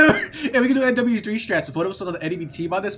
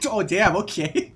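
ordinary talk, nothing marked

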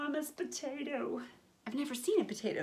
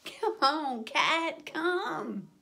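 dog calling cat; 6 semitones higher, much slower than usual. Acted. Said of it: "the dog called the cat".

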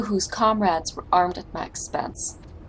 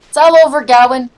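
louder, ringing announcement; triumphant declaration; 3 semitones higher, 10 dB louder than usual. Acted. Inspector catching Gawain, triumphant loud declaration. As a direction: proclaim the capture proudly.

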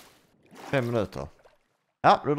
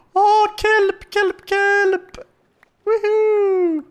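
much higher, louder delighted squealing; thrilled squeal; 6 dB louder, much slower than usual. Real, not acted. Player finally finds kelp, squealing with joy. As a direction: shriek with joy like finding gold.

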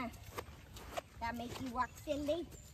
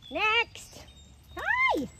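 bright, high-pitched hello to the horses, much louder; excited high greeting; 9 semitones higher, 12 dB louder than usual. Real, not acted. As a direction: squeal a greeting.

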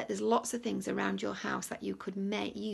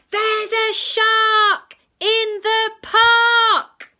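very high, loud, slow scream; shout; 12 semitones higher, 15 dB louder, much slower than usual. Acted. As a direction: shout the alarm. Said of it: "about to shout".

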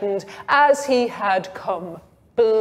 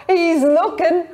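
giggling, higher and louder; laughing; 7 semitones higher, 4 dB louder than usual. A narrator's reading. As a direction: let a giggle spill through.

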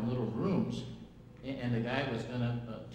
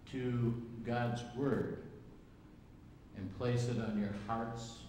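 slow earnest preaching; slower than usual. Acted. Slow emphatic preaching close.

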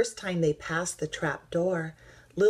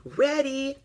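high-pitched starting call; shout; 10 semitones higher, 4 dB louder than usual. A narrator's reading. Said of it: "shouted Rabbit".

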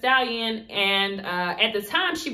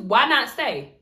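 ordinary talk

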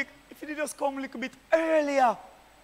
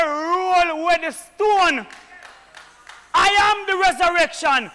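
full-force preaching; 2 semitones higher, 5 dB louder than usual. Acted. Preacher thundering the resurrection to the congregation, full-force preaching.

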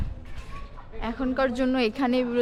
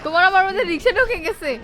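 playful loud; 6 semitones higher, 7 dB louder than usual. Pretend. Playful sung-out joke line.

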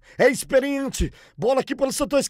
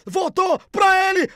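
ordinary delivery

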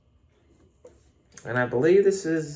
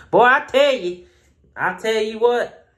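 hammed-up country accent; 8 semitones higher, 5 dB louder than usual. Shown as a demonstration. Reviewer mocking his own country accent, hammed-up. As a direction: ham up the country accent on purpose. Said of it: "my country accent just came out".

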